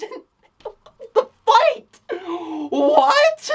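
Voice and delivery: cracking up mid-word, voice squeaking high; laughing. Real, not acted. Reviewer cracking up at the ridiculous fight scene, laughing high. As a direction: laugh helplessly through the words.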